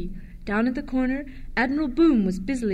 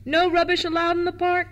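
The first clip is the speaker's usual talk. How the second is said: much higher, loud official call; keeper shouting; 7 semitones higher, 4 dB louder than usual. A narrator's reading. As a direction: keeper bawling as he sweeps along. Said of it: "shouted the keeper".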